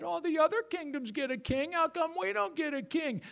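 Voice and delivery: high, nasal-free mock whine of a complaining crowd; hammed-up high whining complaint. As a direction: whine it up high like a sulking people. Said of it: "That's my whining voice".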